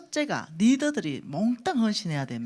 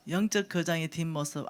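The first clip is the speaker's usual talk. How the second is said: ordinary talk, nothing marked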